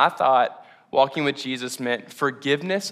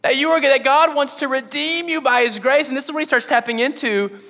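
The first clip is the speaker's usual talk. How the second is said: higher, louder, impassioned preaching; preaching at full force; 9 semitones higher, 5 dB louder than usual. Acted. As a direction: drive the promise home to the church. Sermon climax.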